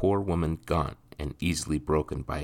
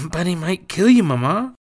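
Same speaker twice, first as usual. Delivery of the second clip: put-on much higher, louder boy's grunt; boy in pain; 11 dB louder than usual. Boy fearing for his mother, grunting in pain. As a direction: push it out through sore ribs. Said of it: "said in a grunt as pain lanced".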